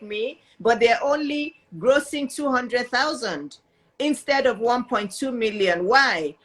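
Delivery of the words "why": ordinary talk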